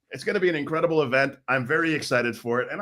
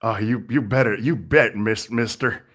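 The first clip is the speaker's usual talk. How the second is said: lightly put-on Western cowboy accent; 3 semitones lower than usual. Commentator answering his co-host in a light Western cowboy accent. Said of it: "do that whole ... in that accent?".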